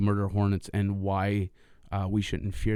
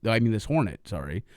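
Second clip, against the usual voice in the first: usual speaking voice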